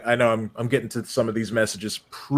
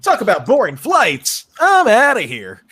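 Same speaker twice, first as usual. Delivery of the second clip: higher, cocky game-hero delivery; hammed-up game quote; 8 semitones higher, 4 dB louder than usual. Streamer quoting the Sonic Adventure two intro, hammed-up hero voice. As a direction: deliver a cheesy action one-liner.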